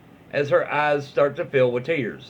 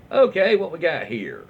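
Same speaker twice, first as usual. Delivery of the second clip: normal talking voice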